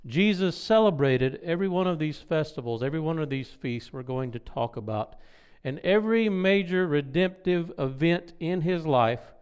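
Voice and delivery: ordinary talk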